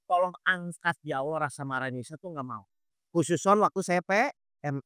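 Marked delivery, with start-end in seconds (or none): 0.1-2.7 low, soft plea that fades away; pleading prayer. Acted. Ustadz models asking Allah to lift anger, softly pleading. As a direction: beg quietly, voice falling off. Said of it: "baru Ibu minta tolong sama Allah".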